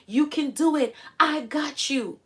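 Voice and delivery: high, slow reassuring coax; a parent's soothing encouragement. Retold or imitated. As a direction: gently urge a child to jump in. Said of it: "the parent coaxed them like".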